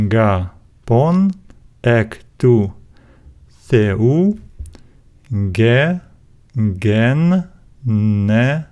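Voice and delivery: each Greek syllable alone, pauses between. Shown as a demonstration. Teacher dividing a Bible verse for learners, syllable by syllable. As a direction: read it out one separated syllable at a time. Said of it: "divide the words into syllables".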